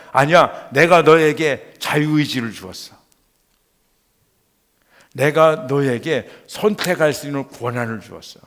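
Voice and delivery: loud, commanding rebuttal; booming insistence in God's voice; emphatic divine answer. Retold or imitated. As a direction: declare it forcefully to the doubter. Voices the Lord's firm answer.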